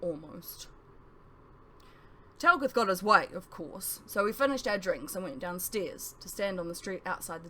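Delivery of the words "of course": plain reading voice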